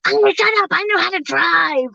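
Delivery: high, irritated mock telling-off; snapping back. Cussing out her backseat-driving husband, high irritated snap. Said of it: "I start cussing him out because I'm like".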